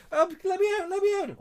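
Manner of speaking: tiny high-pitched squeaky voice begging out; mock trapped plea. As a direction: squeak a pretend cry to be let out. Joking trapped food crying out.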